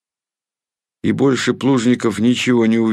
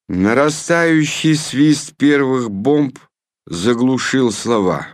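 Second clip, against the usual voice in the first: ordinary delivery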